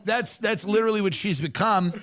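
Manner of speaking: normal talking voice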